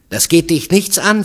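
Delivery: loud, high, heated retort; vehement. A narrator's reading. Woman warding off questions about her husband, vehement. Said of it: "sagte sie heftig".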